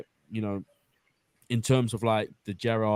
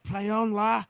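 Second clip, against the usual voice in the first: high-pitched imitated player's call, only slightly raised in volume; Trent Alexander-Arnold shouting; 11 semitones higher, 3 dB louder, slower than usual. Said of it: "You hear Trent shout".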